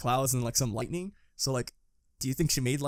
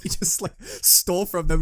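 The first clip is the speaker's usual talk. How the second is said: normal talking voice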